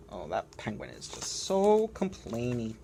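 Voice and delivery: ordinary delivery, nothing marked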